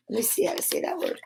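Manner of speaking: fast quiet aside; muttering to self. Real, not acted. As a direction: mumble it quickly to yourself. Muttered aside while checking book.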